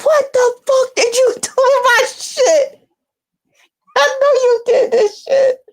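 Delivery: hammed-up furious man yelling at her; mock angry boyfriend. Imitating him yelling about his ruined outfit.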